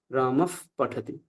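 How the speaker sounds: রামফ with a slow breathed f released before পঠতি; half ফ. Shown as a demonstration. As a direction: let air escape through the lips ending রামফ. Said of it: "ওষ্ঠটাকে release করব". (অর্ধ ফকার উচ্চারণ)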